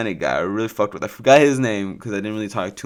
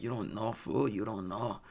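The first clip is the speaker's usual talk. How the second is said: Chris; 13 dB quieter than usual. Retold or imitated. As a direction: imitate a faded homie's accent, barely voiced. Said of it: "this is how he talks".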